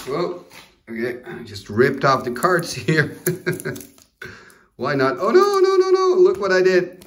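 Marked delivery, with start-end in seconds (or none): 5.2-7.0 high panicked cries of no no; real panic over a torn card. Real, not acted. Card ripped by accident.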